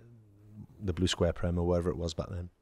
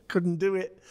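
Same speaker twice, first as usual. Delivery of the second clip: laughing; 10 semitones higher, slower than usual. Real, not acted. Laughing at own nerves.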